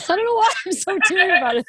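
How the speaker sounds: laughing through happy tears, fast and high; teary laugh. Real, not acted. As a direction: let the tears and giggles spill out together. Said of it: "You're crying".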